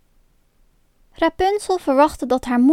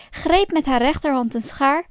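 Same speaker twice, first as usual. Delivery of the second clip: ordinary delivery, nothing marked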